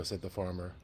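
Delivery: lively read-aloud storytelling voice; story narration. A narrator's reading. Teacher reading a picture book to the class, storytelling.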